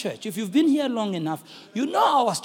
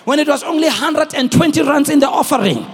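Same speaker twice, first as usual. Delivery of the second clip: starts strong, climbs higher and louder to brighter; building preaching; 3 semitones higher, 8 dB louder than usual. Acted. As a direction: build the crowd up, word by word.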